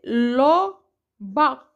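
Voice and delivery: "lo" and "ba" clipped apart as two separate syllables; syllable split. Shown as a demonstration. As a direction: say "lo", then "ba", as two beats. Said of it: "je vais dire".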